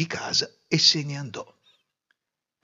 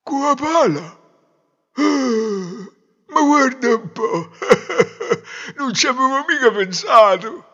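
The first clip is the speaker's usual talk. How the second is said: high, loud dimwit with a silly chuckle; amazed simpleton; 12 semitones higher, 8 dB louder than usual. Acted. Fool hearing of the shovel, astonished put-on voice. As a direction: marvel like a fool at an obvious idea.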